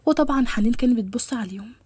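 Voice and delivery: ordinary delivery, nothing marked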